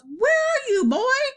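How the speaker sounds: high, loud searching shout. A narrator's reading. Daddy looking for the invisible boy, high shout. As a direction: shout for the missing boy, pitched right up. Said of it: "and he shouts".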